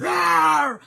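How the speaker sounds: big loud roar, getting big; bear roar. Shown as a demonstration. Showing viewers how to face a bear, roaring. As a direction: let rip a loud roar to scare a bear. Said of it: "And you're like".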